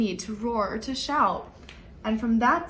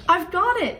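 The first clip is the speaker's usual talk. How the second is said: excited mouse; 7 semitones higher than usual. Acted. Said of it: "he said".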